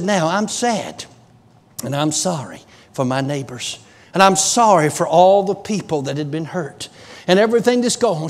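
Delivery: low, soft, sorrowful voice; a touch of grief for the neighbors; imitating sorrow. Retold woman grieving neighbors.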